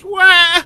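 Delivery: high-pitched fake wail; mock crying. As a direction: bawl in an exaggerated falsetto. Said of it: "She's fucking crying like a fucking bitch".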